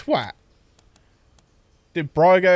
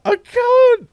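helpless laughter; 8 dB louder than usual. Real, not acted.

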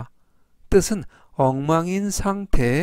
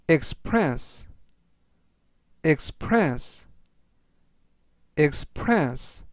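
express read out slowly and deliberately; slow word reading; 3 dB quieter, much slower than usual. Shown as a demonstration. Teacher reading vocabulary for learners to repeat, slowly. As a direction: say express slowly, clearly, for repetition. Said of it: "제가 천천히 세 번씩 읽어드리고요".

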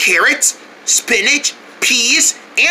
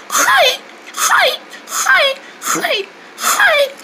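high, breaking "hi" squeaks; strange squeaky noise; much slower than usual. Acted. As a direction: keep squeaking out a broken voice. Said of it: "don't ever make that noise again".

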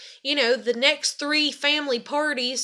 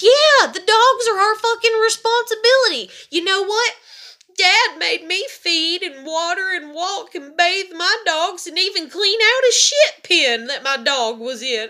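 loud, high-pitched heated rant; real indignant outburst; angry rebuttal; 6 semitones higher, 7 dB louder than usual. Real, not acted. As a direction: fire back at the gossip, loud and high. Angry rebuttal about the dogs.

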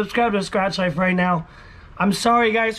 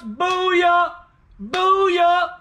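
excited shouting; 8 semitones higher than usual. Real, not acted. Celebrating again.

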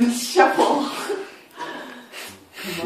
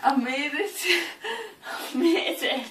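laughing; 6 semitones higher, 6 dB quieter than usual. Real, not acted. Drunk relief at top of stairs.

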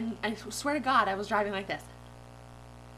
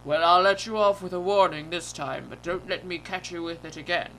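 lower, loud officer voice; police officer; 3 semitones lower, 6 dB louder than usual. Retold or imitated. Officer letting a driver off with a warning, low cop voice. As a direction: warn with put-on authority. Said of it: "The officer goes".

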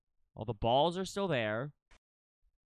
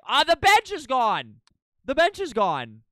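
loud, higher shout of discovery; excited shout; 7 semitones higher, 11 dB louder than usual. Real, not acted. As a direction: yell it, thrilled to spot it. Spots missing bench, yells.

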